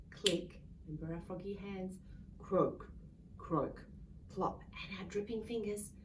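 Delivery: plain reading voice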